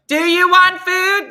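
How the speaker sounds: slow, very high, very loud call; yelling to dog. Real, not acted. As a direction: holler it slowly for a dog that can't hear. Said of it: "He can't, I'm yelling".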